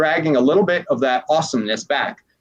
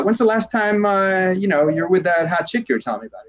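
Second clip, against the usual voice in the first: higher, casual ribbing, speeding up at the end; playful teasing question; 5 semitones higher than usual. Retold or imitated. Coach teasing a client about a date, playful. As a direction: nudge him like a buddy to change his state. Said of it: "You know, or like".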